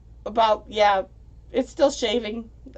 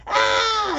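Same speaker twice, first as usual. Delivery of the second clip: much higher short yell of delight; imitated scream; 4 dB louder than usual. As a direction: let out a quick excited scream.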